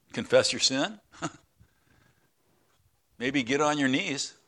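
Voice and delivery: ordinary talk, nothing marked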